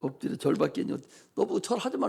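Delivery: usual speaking voice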